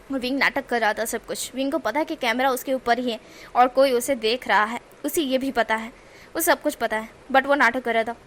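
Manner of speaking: plain reading voice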